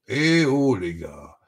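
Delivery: ordinary delivery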